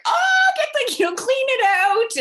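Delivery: high, loud, urgent nurse-mom voice; hammed-up high loud mom instructing; imitating mom. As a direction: mimic mom urging them to clean it.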